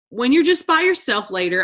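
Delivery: ordinary delivery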